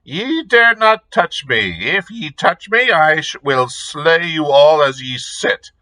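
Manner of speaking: high, thin crone voice; piping old witch. A narrator's reading. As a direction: pipe it up like an ancient witch. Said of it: "she piped".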